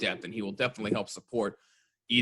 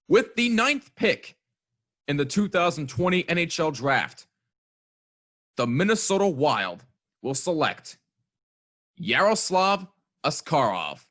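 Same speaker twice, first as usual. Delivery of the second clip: draft announcer; 5 semitones higher, 4 dB louder than usual. Acted. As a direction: announce the pick like the commissioner. Mock draft pick announcement.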